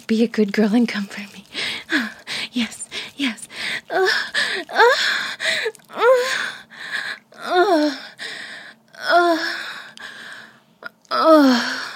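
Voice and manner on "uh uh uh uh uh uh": loud high grunts at release; climax grunts. Acted. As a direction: grunt louder and higher.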